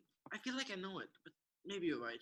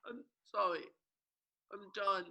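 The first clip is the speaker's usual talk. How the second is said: ordinary talk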